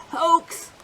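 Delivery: usual speaking voice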